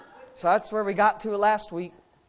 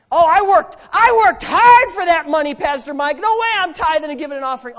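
high, loud complaining churchgoer; whiny objector; 5 semitones higher, 8 dB louder than usual. Retold or imitated. Preacher mocking a stingy churchgoer, whiny and loud. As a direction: protest like a stingy member clutching his money. Congregant voiced as greedy objector.

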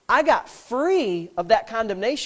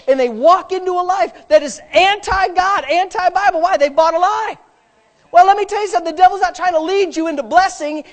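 louder, higher preaching climb; preaching at full force; 10 semitones higher, 10 dB louder than usual. Acted. Pastor preaching hard to the congregation, loud and high. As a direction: build the sermon to a loud ringing peak.

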